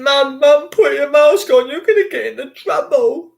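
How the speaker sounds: high, loud mimicked child; nagging kid. Kid telling mum to put mask on, high nagging voice. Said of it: "is nagging".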